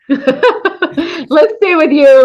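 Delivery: bashful laughing deflection. Real, not acted. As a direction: laugh off the praise.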